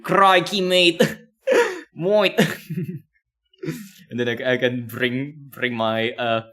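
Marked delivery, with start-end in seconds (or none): 0.0-0.6 louder, hammed-up Australian Crikey; mock Australian accent. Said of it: "I'm gonna practice my accent". 0.6-1.0 mock Australian accent. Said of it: "I'm gonna practice my accent". 2.0-2.3 broad mock Australian. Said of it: "I'm gonna practice my accent".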